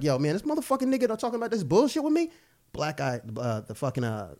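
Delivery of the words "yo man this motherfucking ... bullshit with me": a fast, higher hammed-up thug line; thug character. Playing a thug on set, fast hammed-up thug talk. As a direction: rattle it off like a street tough. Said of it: "I'm like".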